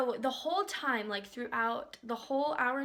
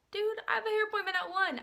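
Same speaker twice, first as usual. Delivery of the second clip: exasperated high-voiced objection; high-pitched protest; 9 semitones higher than usual. Customer inwardly objecting to the makeup artist, high-pitched protest. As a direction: protest with the voice pushed way up.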